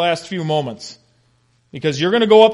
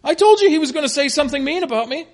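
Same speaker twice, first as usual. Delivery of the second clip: very high-pitched mock-petulant grumble; exasperated whining; 11 semitones higher than usual. Ahab griping to his ally about the prophet, petulant. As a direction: whine it like a sulky king, quickly. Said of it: "throwing up his hands a little bit and say".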